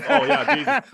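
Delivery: high, loud words through laughter; real laughter. Real, not acted. Cracking up with co-host over film dialogue, laughing high. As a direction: crack up while talking, voice shooting up.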